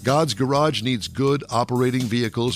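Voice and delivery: usual speaking voice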